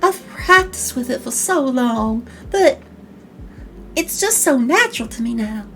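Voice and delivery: put-on genteel Southern belle accent. Voice actor boasting of a practiced accent, Southern belle. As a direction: play a consummate Southern gentlewoman. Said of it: "once I have this accent on".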